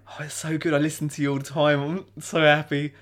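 gushing fan. Retold or imitated. Listener meeting a favourite radio host, retold gushing. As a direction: gush like a thrilled listener.

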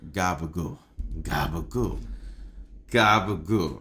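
put-on low Italian-American mobster accent; hammy Italian accent, getting into character. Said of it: "I get to do my Italian accent".

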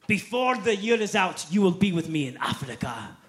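put-on booming German-accented preacher; Reinhard Bonnke. German preacher prophesying to a teenager, loud imitated accent. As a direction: declare it loudly with a German accent. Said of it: "he's this German guy".

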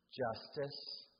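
ordinary delivery

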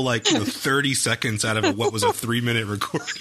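ordinary talk, nothing marked

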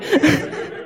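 high light laugh; quick amused laugh at herself; short laugh. Real, not acted. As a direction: laugh at the memory. Laughs at own story.

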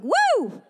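high re-enacted "woo"; high cheer, acting out the thrill; excited whoop. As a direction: whoop with sudden delight. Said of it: "I got excited".

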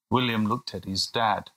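usual speaking voice

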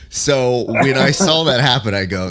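ordinary talk, nothing marked

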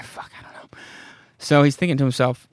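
ordinary talk

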